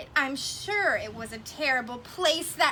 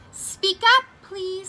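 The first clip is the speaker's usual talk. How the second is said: Horton calls out loud and high to the tiny voice; a loud, high call to a faint voice; 6 semitones higher, 9 dB louder than usual. Acted. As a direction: shout up to be heard by someone tiny. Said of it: "said Horton".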